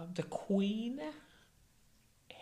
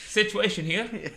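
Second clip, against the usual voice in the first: slow, louder swagger on "Situation here"; mock phone greeting; 5 semitones higher, 7 dB louder, slower than usual. Mimicking Situation answering the phone, louder and slower. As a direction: answer the phone like a cocky TV star. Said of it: "who answers the phone like that?".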